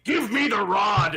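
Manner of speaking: loud, drawn-out villain bellow; imitated loud scream. Podcaster mimicking a film villain for his co-hosts, mock screaming. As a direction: scream it slowly like a hammy movie villain. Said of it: "loudly screaming".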